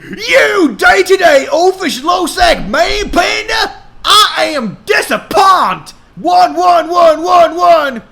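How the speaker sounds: high, loud furious shout; angry dad. A narrator's reading. Billy Ray shouting at Hannah's boyfriend. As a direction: blast the boyfriend.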